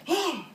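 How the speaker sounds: high mock gasp; hammed-up sharp intake; imitated shocked gasp. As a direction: gasp high like a scandalised mother. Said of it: "she whispers, she's like".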